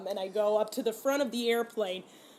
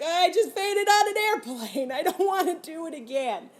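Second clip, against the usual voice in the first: panicked dread; 8 semitones higher, 7 dB louder than usual. Retold or imitated. Dreading the flight home after fainting, high panicky whine. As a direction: squeal it out in half-laughing panic.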